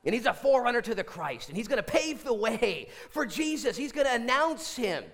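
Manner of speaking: high, loud, ringing proclamation; full-force pulpit announcement; fervent preaching. Real, not acted.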